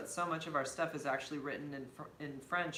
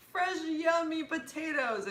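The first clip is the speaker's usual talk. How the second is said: high, loud, drawn-out playful echo; delighted mimicking; 13 semitones higher, 6 dB louder, slower than usual. Echoing friend's funny potato line, delighted mimicking. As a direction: repeat it slowly in a gleeful high voice.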